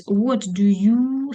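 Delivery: very slow, long-held YOU; open-ended teacher prompt; drawn-out prompt. Shown as a demonstration. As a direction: stretch "you" and leave the question unfinished. Pauses for students to supply verb.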